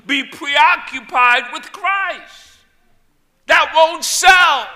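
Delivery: loud, fervent shouting sermon; preaching at full force. Acted. Preacher urges miserable people toward Christ, shouting. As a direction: drive it home to the congregation.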